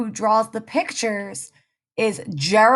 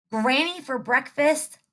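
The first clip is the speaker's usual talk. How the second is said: ordinary delivery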